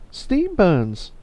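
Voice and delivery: high, drawn-out exclamation; put-on character voice. Game character answers which buns are better, high put-on voice.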